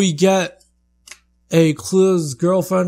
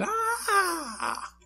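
mock cry; 8 dB quieter than usual. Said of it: "He's like".

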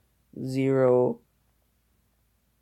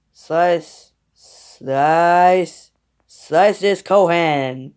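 loud, drawn-out attack call; loud battle call; 3 semitones higher, 8 dB louder, much slower than usual. Real, not acted. Player urging on an attack against Kohan, loud battle call. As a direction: call each slice out loud and slowly. Calling out attacks during fight.

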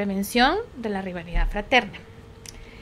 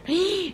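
mock gasp; 7 semitones higher than usual. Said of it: "padre ve a su nena".

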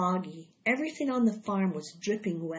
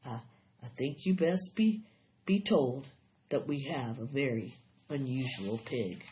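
halting, lowered, carefully controlled; trying to stay steady; 6 semitones lower than usual. A narrator's reading. Husband tells frightened wife of the pig, haltingly steady. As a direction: falter slightly, keeping the voice in check. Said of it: "trying to keep his voice steady".

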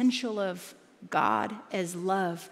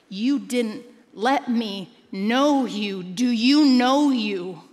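slow emphatic; 7 dB louder, much slower than usual. Acted. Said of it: "slow pointed sermon line".

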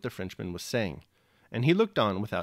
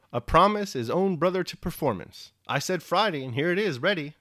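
lightly voiced Platon, pleased and raised in pitch; a hint of a beaming peasant tailor; Platon proud; 6 semitones higher, 4 dB louder than usual. A narrator's reading. Peasant Platon showing off the shirt, pleased.

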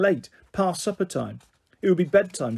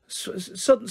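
ordinary delivery

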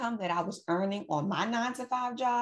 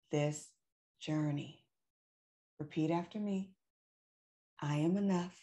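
quiet, slow affirmation voice; soft affirmations; 2 semitones lower, 5 dB quieter, slower than usual. Acted. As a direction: lead them gently through affirmations. Affirmations, repeat after me.

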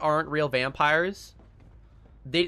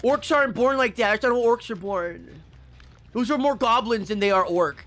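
lightly put-on nitpicking fan, pitched up; higher-pitched mock complaint; mock orc purist; 6 semitones higher, 3 dB louder than usual. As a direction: protest like an outraged fantasy pedant. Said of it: "and be like".

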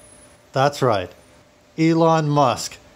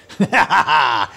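loud staccato stage-villain laugh; villainous laugh; 5 dB louder than usual. Acted. Faux tycoon naming his next victim, villainous laugh. Villain plans to seize planets.

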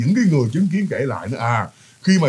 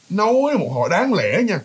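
normal talking voice

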